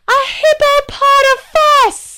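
high, slow comic guard voice; squeaky guard voice. Acted. Guard answering the princess's riddle, high comic voice. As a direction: play the guard way up high and drawn out.

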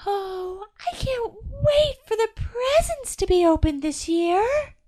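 high, slow angel voice; a sweet little angel longing for presents; eager little angel on the tree. A narrator's reading. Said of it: "a little angel called out".

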